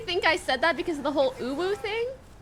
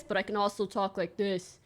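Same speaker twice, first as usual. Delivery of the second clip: deeper, quieter voice; dropping voice deep; 7 semitones lower, 6 dB quieter than usual. Shown as a demonstration. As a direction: drop into a deep register. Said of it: "should do a bit of a deeper voice".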